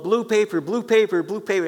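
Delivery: imitating the artist calling for his wife. Painter calling to his wife in the next room, a called-out request. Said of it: "he'd call out".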